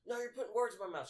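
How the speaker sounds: high, loud mock indignation; mock screaming customer. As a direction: snap back as the irate customer. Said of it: "she's sitting there screaming".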